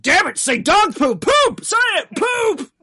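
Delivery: high, loud, barking boss voice; imitating Vince yelling at commentators; mock Vince McMahon. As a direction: yell orders into a headset. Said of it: "Vince McMahon in their ears being like".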